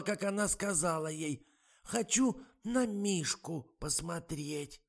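высокий, медленный старушечий голос; ласково уговаривает, как подслеповатая старушка; болтливая старуха. A narrator's reading. (высокий тягучий голос старой актрисы)

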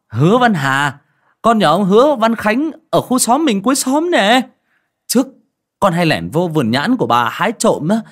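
put-on loud, eager young man, climbing in pitch; exclaiming joyfully. A narrator's reading. Returning young man greeting old neighbour, excited and loud. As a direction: burst out with delighted recognition. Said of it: "anh reo lên".

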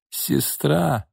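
hoarse, quiet sister's plea. Acted. Irma hoarsely greets her sister. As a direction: rasp the word out softly. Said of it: "хрипло произнесла Ирма Бежецкая".

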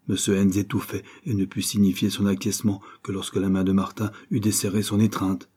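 ordinary delivery, nothing marked

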